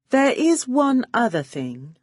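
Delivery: honey-sweet, setting a trap; sweetly. Acted. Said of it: "I said sweetly".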